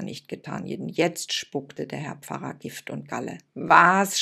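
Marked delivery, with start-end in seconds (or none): 3.5-4.2 loud outraged shout; scandalised priest's shout. Acted. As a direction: yell it in outrage. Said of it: "schrie er".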